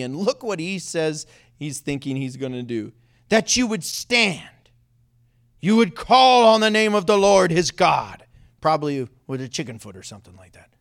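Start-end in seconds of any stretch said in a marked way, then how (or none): 3.3-8.2 loud, stretched ceremonial proclaiming; mock-grand, leaning long on the big words; grand showman. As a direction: declaim it like a pompous ritual. Mimics Naaman's expected showy ritual.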